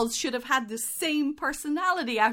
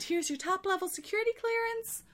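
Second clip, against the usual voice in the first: higher, airy and offhand; casual throwaway; 8 semitones higher, 6 dB quieter than usual. Retold or imitated. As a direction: toss it off like nothing. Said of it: "Just, just like that".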